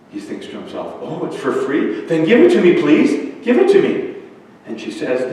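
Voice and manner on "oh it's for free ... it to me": rising to high, loud pleading; eager begging. Acted. Ryan begging the old woman for the free book, eager. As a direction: demand it greedily, pitch leaping up.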